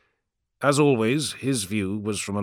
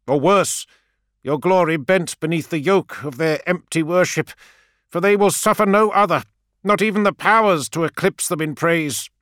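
higher, louder preaching priest; rising prophetic zeal; fervent priest; 8 semitones higher, 5 dB louder than usual. A narrator's reading. Priest's fervent warning speech.

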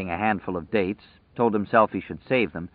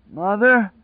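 high, unsure questioning call; a madman's fading hopeful question; high doubtful "Mother?"; weak doubt; 13 semitones higher, 5 dB louder than usual. Acted. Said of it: "now full of weak doubt".

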